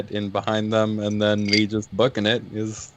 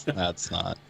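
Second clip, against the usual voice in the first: normal talking voice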